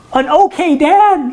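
high, slow, incredulous echo; mock-wounded dad echoing the insult; offended disbelief. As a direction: repeat it back, stung and amazed. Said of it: "And I'm like".

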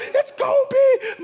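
high, loud, laughing cry of "It's Kobe!"; thrilled squeal of recognition; excited shout. Retold or imitated. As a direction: yell "Kobe" like a starstruck fan. Spotting a celebrity, excited.

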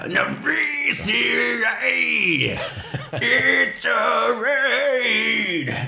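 high shouted joke line; mock shout. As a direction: yell it like a rock fan. Joking shout about Metallica.

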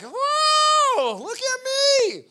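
high, loud, delighted whoa, stretching out look at me slowly; a shopper thrilled by his reflection in the fitting room; amazed at the mirror. Retold or imitated. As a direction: gasp at your own reflection like a new person. Admiring himself in the mirror.

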